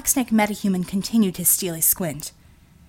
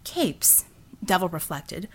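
ordinary delivery, nothing marked

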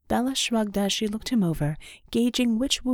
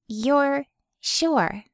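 higher, skeptical question; doubtful; 6 semitones higher than usual. A narrator's reading. Medic doubting her patient, skeptical. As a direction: let her doubt show. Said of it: "Bella asked, doubtful".

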